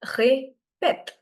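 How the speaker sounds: répète modelled syllable by syllable; flat first E, sharper second E in répète; French pronunciation of répète. Shown as a demonstration. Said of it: "The pronunciation is".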